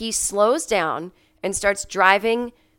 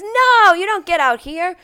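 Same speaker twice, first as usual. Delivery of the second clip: mock teasing driver; 12 semitones higher, 7 dB louder than usual. Driver teasing her at station.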